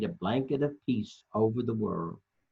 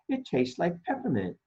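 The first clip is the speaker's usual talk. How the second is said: a touch higher, dreamy toy voice; Stingray; 6 semitones higher than usual. Acted. Storyteller lightly voicing Stingray, a little higher. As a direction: savour the taste lightly. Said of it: "says Stingray".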